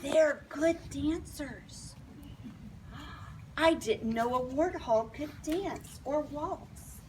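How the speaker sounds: plain reading voice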